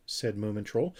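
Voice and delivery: ordinary delivery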